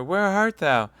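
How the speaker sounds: high, loud desperate pleading; frightened cry; dying plea. Acted. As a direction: beg him not to leave. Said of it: "cried Madame Bonacieux".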